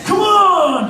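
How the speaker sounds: put-on rally the crowd like a drunk fan; drunk fan yelling. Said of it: "he's going".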